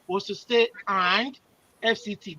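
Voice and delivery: normal talking voice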